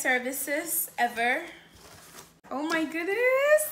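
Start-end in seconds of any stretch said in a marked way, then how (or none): 2.5-3.6 high, loud burst of delight; excited gasp. Real, not acted. Vlogger opening her new camera, excited. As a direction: squeal it out at the first look.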